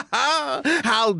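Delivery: slow, laughing how generous; amused laugh. Acted. Host laughing at guest's offer of wine, amused. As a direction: chuckle it out slowly.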